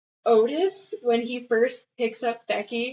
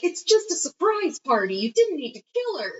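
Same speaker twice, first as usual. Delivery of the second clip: high, exasperated skit voice; pitched-up pretend disbelief; mock protest; 8 semitones higher than usual. Friend objecting to a killing over a party, mock protest.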